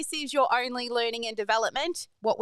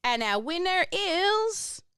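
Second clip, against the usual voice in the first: higher, slow drawn-out build to the name; announcer reveal; 7 semitones higher, slower than usual. Acted. Host announcing the prize winner to the live audience, slow suspense then higher. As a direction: stretch it out like a game show host.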